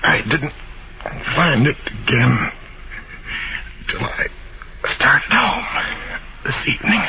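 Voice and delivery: halting deathbed explanation, broken by long pauses; dying. Acted. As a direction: labor for breath every few words. Dying man explains, gasping.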